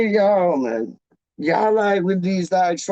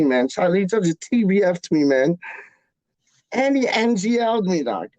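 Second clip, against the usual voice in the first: ordinary talk, nothing marked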